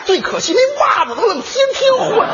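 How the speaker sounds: high, whiny cry of comic outrage; mock outrage. Complaining to his partner about Huzi's socks, high mock wail. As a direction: wail it like a petty grievance.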